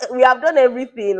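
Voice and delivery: loud, high protest; indignant protest. Retold or imitated. Telling the friend loudly that they have done everything. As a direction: insist loudly that you did everything.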